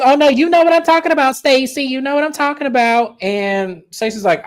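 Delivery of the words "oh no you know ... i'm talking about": high, loud, quick taunt. Retold or imitated. Kiana loudly taunting Stacey about the accusation. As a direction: needle her, you know what you did. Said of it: "And she goes".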